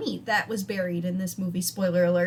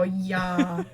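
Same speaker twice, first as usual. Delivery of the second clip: drawn-out Wisconsin yeah; a touch of mom's thick accent; 3 semitones lower than usual. Retold or imitated. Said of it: "Sometimes I hear her go".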